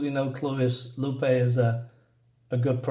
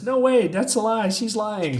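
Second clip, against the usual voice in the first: raised pitch, lightly acted alarm; mild panic; 5 semitones higher than usual. One of the girls accuses Lupe of lying, mild panic. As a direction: protest in a higher voice, mildly panicked. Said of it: "So they're panicking".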